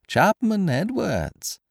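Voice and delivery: very high, slow singsong call; mocking echo. A narrator's reading. Stranger parroting Kesselbach's call for help, mocking echo. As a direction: mimic his cry without the volume. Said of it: "echoed the stranger".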